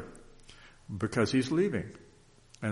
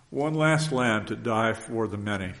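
moved, emotional; slower than usual. Real, not acted. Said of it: "forgive me for being emotional".

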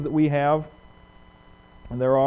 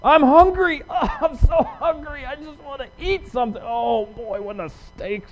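much higher, mock whining over hunger; Jesus tempted to pine for a steak, mock whine; whiny hungry voice; 6 semitones higher than usual. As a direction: play a hungry man pining for food. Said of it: "Focus on how you feel".

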